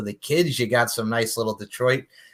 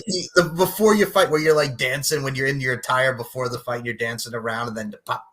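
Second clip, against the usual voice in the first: ordinary delivery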